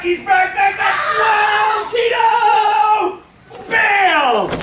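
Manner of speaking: rising, high wind-up into a held shout; playful launch cry. Pretend. Teacher pulling Tito back and launching him, high excited shout. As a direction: build high, then fire off the bang. Launching a child in pretend game.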